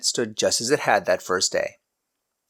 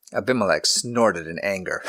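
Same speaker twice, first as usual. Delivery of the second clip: ordinary delivery, nothing marked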